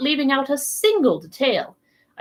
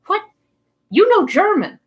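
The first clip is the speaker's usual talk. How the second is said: astonished exclamation; 10 semitones higher, 5 dB louder than usual. Acted. Professor to Conseil, stunned he speaks German, exclaiming. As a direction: voice jumping high and loud in disbelief. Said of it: "I exclaimed".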